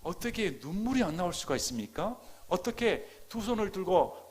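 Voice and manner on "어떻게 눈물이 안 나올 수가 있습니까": normal talking voice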